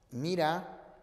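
normal talking voice

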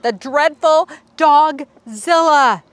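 slow, looming announcement of the monster; storyteller unveils the dreaded beast; dramatic reveal. A narrator's reading. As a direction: drag it out like a movie trailer. Monster's grand entrance.

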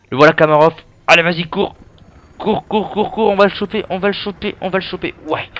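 high, loud urging on the run; excited chase shouting. Real, not acted. Chasing the target in game.

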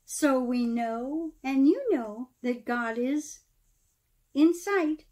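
lively storybook read-aloud, rising and falling; storyteller reading verse aloud; reading to children. Acted. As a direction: read the Christmas rhyme to a room of kids. Reads Christmas poem to children.

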